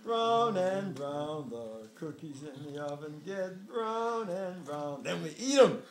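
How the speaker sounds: slow, loud storybook voice; sing-song read-aloud for a child; reading to a toddler. Acted.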